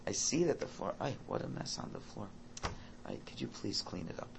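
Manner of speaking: hushed, patient request; calm gentle tone. Retold or imitated. Father quietly asking his son to clean up, hushed and patient. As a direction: softly coax without any anger. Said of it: "In that, in that tone".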